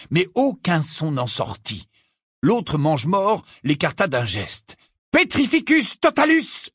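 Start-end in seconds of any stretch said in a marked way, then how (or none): 5.1-6.7 high-pitched shouted incantation; Harry yelling. A narrator's reading. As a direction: fire off the spell in a cry. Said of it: "hurla alors Harry". (sort hurlé d'une voix aiguë)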